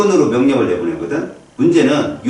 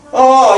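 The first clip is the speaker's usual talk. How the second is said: high, loud mock scream; acted pain cry; 10 semitones higher, 7 dB louder than usual. Teacher showing the reflex comes first, acted pain cry. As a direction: burst out in exaggerated pain. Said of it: "이러지".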